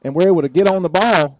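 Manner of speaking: loud, high excited call. Real, not acted. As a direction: call the fumble recovery with a burst of joy. Fumble recovered, excited call.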